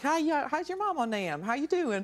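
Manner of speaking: friendly mock-Southern greeting; Southern accent. Guest greeting a Southerner in their accent, put-on Southern twang. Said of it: "someone who has a Southern accent".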